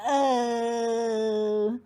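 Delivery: loud pained giant groan; the needle goes in. Acted. The giant groans as the needle goes in. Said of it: "groaned the giant".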